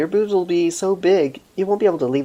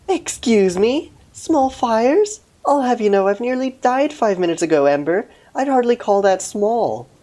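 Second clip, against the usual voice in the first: high, offended cat character; huffy high cat, a bit louder; indignant cat voice; 8 semitones higher, 4 dB louder than usual. Acted. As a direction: protest like an insulted cat, pitched up.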